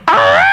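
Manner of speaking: one sudden high, loud shriek of a cry; sudden yell. Retold or imitated. A lover shrieks at the climax, sudden high yell. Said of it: "you go".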